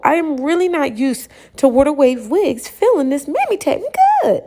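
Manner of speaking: rising to a high squeal on technique good; excited gush. Real, not acted. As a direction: gush with delight, pitch shooting up at the end. Raving about the wig.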